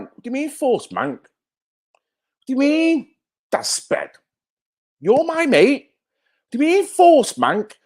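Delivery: high-pitched, put-on offence; mock indignation. Streamer challenging a chatter over "forced Manc", mock indignant. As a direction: act affronted, pitch leaping up.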